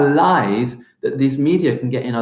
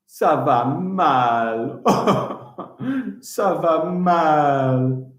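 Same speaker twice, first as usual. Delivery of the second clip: mock glum ça va mal; much slower than usual. Shown as a demonstration. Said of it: "That means I am not good".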